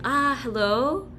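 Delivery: high, lilting, melodic greeting; singsong phone voice. Retold or imitated. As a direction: sing the words up and down sweetly. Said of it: "I would just go and sing".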